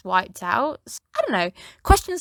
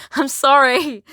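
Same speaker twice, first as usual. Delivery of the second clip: giggling through the apology, slower; laughing apology; 5 semitones higher, 7 dB louder, slower than usual. Real, not acted. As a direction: say sorry while still laughing. Apologizes while laughing at own joke.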